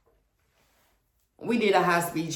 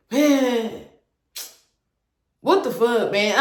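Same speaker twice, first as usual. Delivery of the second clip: annoyed, louder gruff man at the door; frustrated; 4 semitones higher, 4 dB louder, slower than usual. Retold or imitated. Boyfriend at the door, annoyed at her knocking. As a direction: grumble it like an irritated boyfriend caught out. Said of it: "He answered the door, frustrated".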